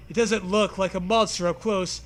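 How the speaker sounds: ordinary delivery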